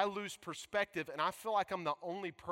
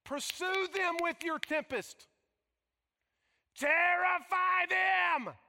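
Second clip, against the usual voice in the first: loud, pleading, furious cry to God; raised-voice curse prayer; angry prayer; 8 semitones higher, 4 dB louder than usual. Acted. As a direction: spit out the psalmist's rage. Preacher voicing psalmist's angry prayer.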